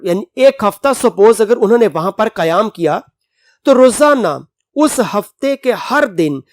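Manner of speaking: ordinary delivery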